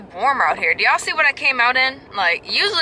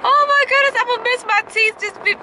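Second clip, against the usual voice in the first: high, loud oh my goodness, then a near whine; real nerves spilling out; much higher, fretting about losing her teeth; nervous wail; 4 dB louder than usual. Real, not acted. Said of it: "I'm a little nervous".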